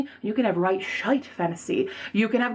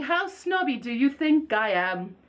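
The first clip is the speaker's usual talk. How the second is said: ordinary talk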